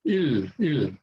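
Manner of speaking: "il" repeated carefully as a separate syllable; pronunciation demo. Shown as a demonstration. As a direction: model "il" with an E vowel and an L-like ending. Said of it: "More like an L".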